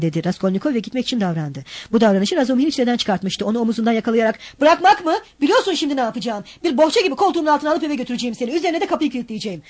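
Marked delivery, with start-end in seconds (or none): 4.6-9.6 angry threat. Acted. Razumihin threatening to carry him home.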